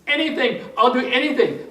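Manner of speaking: high, loud, acted desperation; mock-frantic begging; desperate pleading. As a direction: plead frantically as the panicked patient. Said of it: "The guy was freaking".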